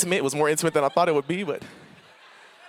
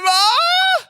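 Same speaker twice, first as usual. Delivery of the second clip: very high, loud, long-held yell; screamed call for mom; 7 dB louder than usual. Retold or imitated. Passionate kid yelling for mom again and again, screaming. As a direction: shriek it out for Ma, stretched. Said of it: "I was screaming".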